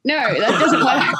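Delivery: laughing while correcting her; a laughing no, slowed right down; half-laughing correction; laughing protest. Retold or imitated. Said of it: "And I was like".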